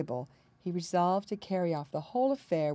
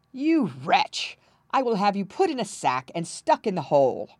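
loud, raised royal outburst; furious threat; 4 semitones higher, 7 dB louder than usual. A narrator's reading. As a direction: threaten him like an enraged king. King threatens the prince.

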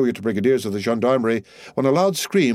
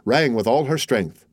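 usual speaking voice

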